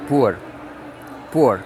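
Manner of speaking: poor said with a u sound, like pur; poor pronounced pur. Shown as a demonstration. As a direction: say poor with a u vowel. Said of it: "como se fosse P U R".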